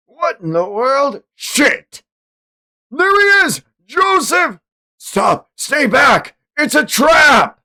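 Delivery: high, loud warning cries; panicked comic hero calling out; hammed-up alarmed shout. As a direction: yell a warning to Joseph. Acting comic panel dialogue, shouting.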